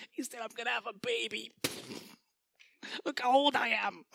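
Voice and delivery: high, hushed, laughing disbelief; a giggly old woman peeking from the tent; half-whispered laughing scoff; giggling Sarah. Acted. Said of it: "her kinda peeking through the tent".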